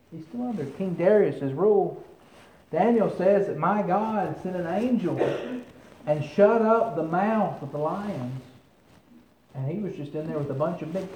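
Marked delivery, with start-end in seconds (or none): none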